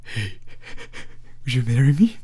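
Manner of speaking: lightly voiced creepy ask with a laugh in it; a hint of creep, giggling through the question; creepy proposal. Shown as a demonstration. Streamer showing chat a creepy way to propose. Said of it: "if you say it like".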